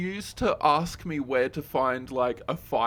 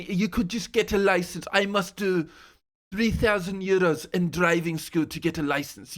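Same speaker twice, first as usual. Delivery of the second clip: put-on higher, louder French-accented rant; mock French accent, ranting; 6 semitones higher, 4 dB louder than usual. Classmate ranting at [name] about licenses, in a louder mock French accent. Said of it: "a French accent ... mimic her yelling at me".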